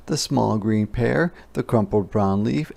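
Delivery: ordinary delivery, nothing marked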